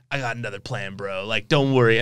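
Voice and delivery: low quiet bro voice. Retold or imitated. Imitating the same low casual line.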